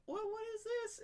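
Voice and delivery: put-on high girlfriend voice. Girlfriend gets the ring, high and curious. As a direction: ask it in a lilting high voice. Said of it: "she's like".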